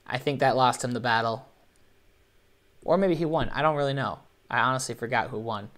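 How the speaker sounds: ordinary talk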